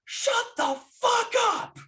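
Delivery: very high, loud, screeching yell; furious yell. Retold or imitated. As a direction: scream it at the talking kid. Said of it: "she just yells".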